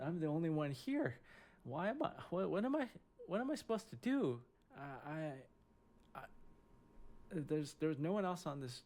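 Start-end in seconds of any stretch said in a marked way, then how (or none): 0.0-4.4 rising, higher-pitched bewildered self-questioning; mock-helpless alarm, voice lifting at the end; retold panic. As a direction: stammer it out, pitch climbing as you cast about helplessly. Retold panic alone with fighting ducks.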